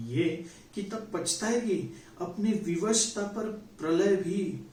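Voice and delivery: measured, solemn reading of a Hindi poem with lingering refrains; poem recitation. Acted. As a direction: recite it like a stage poet.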